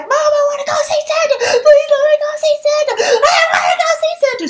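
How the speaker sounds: put-on high, fast wailing kid tantrum; a shrieking child meltdown, pleading hard; screaming tantrum. Little girl screaming at mom in the mall. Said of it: "I just started screaming and crying".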